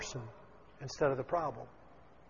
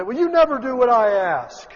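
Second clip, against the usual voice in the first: mock complaint; 6 semitones higher, 7 dB louder than usual. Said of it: "sometimes they'll say".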